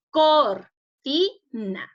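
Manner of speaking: "Cortina" very slowly split into cor, ti, na; syllable by syllable. Shown as a demonstration. Teacher divides the word for pupils at home, "Cortina" syllable by syllable. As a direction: say "Cortina" in separate, drawn-out syllables with gaps. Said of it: "está dividida en sílabas".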